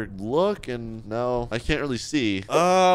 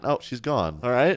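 ordinary talk

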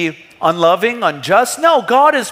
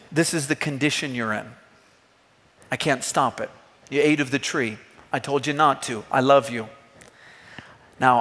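much softer and lower, gentle and sorrowful; tender loving God; 6 semitones lower, 4 dB quieter than usual. Acted. God to Adam and Eve after the fall, soft and tender. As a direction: speak as a grieving father who still loves. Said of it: "That's the heart of God".

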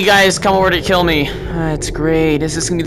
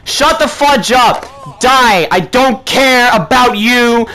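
slow, emphatic, raised curse; angry outburst; 3 semitones higher, 3 dB louder, slower than usual. Real, not acted. Player tells Foxy off after losing, angry outburst. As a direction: hammer out each word in anger.

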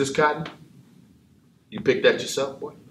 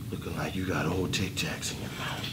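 ordinary delivery

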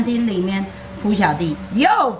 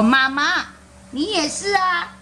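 put-on high-pitched kid voice pointing at mom; little boy tattling on mom; childish accusation; 9 semitones higher than usual. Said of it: "他说".

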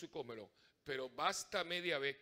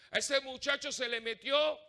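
exclaiming; 10 semitones higher, 10 dB louder than usual. Retold or imitated. People say he is possessed.

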